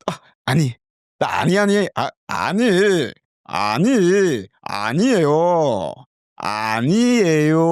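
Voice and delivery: high, sung "아니에요" with falling ends; Korean intonation. Shown as a demonstration. Learner drilling Korean negation with native intonation, high. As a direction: practise Korean intonation, over the top. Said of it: "we want to practice with the Korean intonation".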